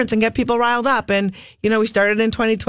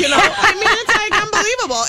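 laughing disbelief; 10 semitones higher than usual. Real, not acted. Exasperated laughing rant.

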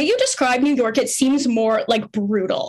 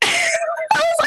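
laughing disbelief; much faster than usual. Real, not acted.

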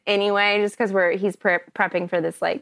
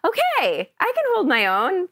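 higher-pitched voiced inner pep talk; upbeat self-talk; 9 semitones higher than usual. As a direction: lift it high, reassuring yourself. Imagined upbeat self-talk after audition.

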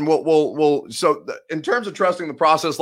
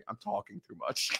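laughing; 12 dB quieter than usual. Real, not acted. Laughing at his own rambling.

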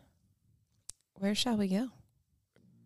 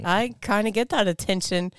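ordinary talk, nothing marked

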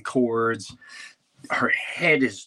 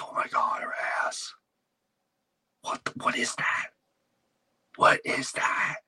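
horrified hushed gasping whisper; aghast husband whispering at what he sees; shocked whisper; 4 dB quieter than usual. Retold or imitated. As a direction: whisper it in full-blown shock. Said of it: "I went full-blown shock".

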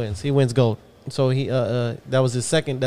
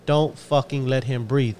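slow hard coaching; 3 dB quieter, slower than usual. Retold or imitated. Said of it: "he's like".

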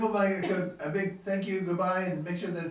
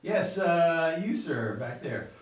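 normal talking voice

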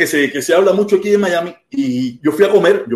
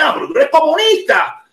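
high, scandalized imitation of the heckler; put-on indignant cry; mock accusation; 10 semitones higher than usual. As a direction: accuse as an outraged man would, pitch way up. Acted accusation in a retold quarrel.

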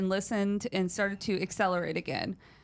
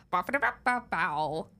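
mock stumbling bow bow; flubbed intro. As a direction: stammer through it playfully. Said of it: "the Chris's flub where he goes".

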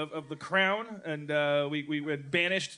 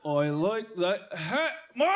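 mock Australian; 2 semitones higher, slower than usual. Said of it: "Don't move your lips or your tongue".

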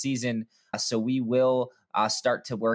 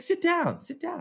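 put-on much higher, commanding little-kid voice; bossy toddler voice; slower than usual. Dad imitating his toddler bossing him to sit. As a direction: order everyone to sit like a tiny boss. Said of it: "He's like".

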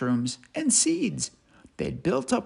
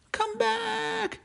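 high calling squirrel; desperate squirrel call; 4 dB quieter than usual. Acted. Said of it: "shouted Cyril".

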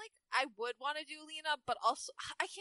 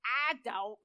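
huffy McCree; 4 semitones lower than usual. Acted. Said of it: "McCree huffed".

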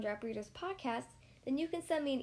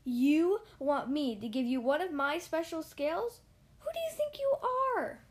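high, offended and louder; indignant cry; 4 semitones higher, 5 dB louder than usual. A narrator's reading. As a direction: scoff at the nerve of the little fish. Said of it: "cried the Rainbow Fish".

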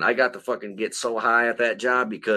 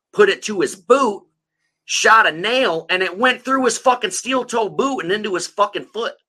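rising disbelief; 10 semitones higher, 4 dB louder than usual. Real, not acted. Retelling the nail gun accident.

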